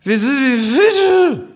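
high, slow kangaroo voice; drunk kangaroo. Acted. Kangaroo philosophizing at the bar, high slow drawl. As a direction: stretch out the kangaroo's drunken wisdom.

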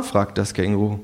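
usual speaking voice

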